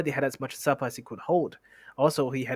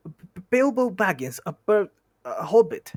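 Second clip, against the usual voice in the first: higher, stammering and shaken; frightened stammer; 7 semitones higher, 5 dB louder than usual. Acted. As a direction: blurt out your name in terror. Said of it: "shaken all over".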